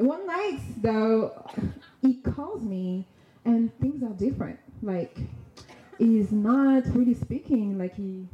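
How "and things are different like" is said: usual speaking voice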